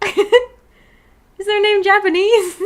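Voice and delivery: high, loud and slow mock shock, laughing; mock surprise. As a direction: ham up the startled double take. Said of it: "so I was like".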